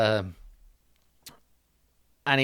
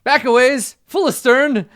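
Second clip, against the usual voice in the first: high, booming shout across the water; knight hailing; 11 semitones higher, 13 dB louder, slower than usual. Acted. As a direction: bellow commands to another boat. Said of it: "he's like".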